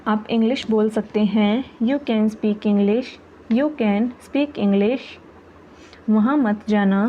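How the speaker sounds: ordinary talk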